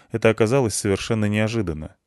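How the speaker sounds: ordinary delivery, nothing marked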